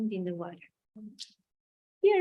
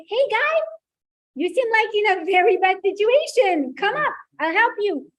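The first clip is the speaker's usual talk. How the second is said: rowboat guy calling out; 8 semitones higher, 5 dB louder than usual. Acted. Rowboat man calls to stranded man.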